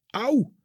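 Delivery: sharp high yelp of pain, mild; a short pained cry as he's struck. A narrator's reading. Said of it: "schreeuwt de jonge man". (korte kreet van pijn)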